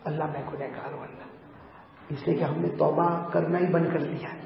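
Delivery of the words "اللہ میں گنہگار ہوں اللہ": hushed sinner's prayer; humble supplication. Acted. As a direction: murmur a confession to God. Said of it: "اللہ سے مانگو".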